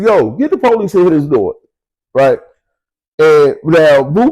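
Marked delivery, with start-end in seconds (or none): 0.0-1.6 hollered out. Retold or imitated. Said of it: "hollered out".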